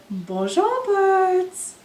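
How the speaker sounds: lightly voiced lion, rising high on birds; a friendly lion calling hello; lion's greeting. Storyteller voicing the lion greeting the birds, bright and lifting.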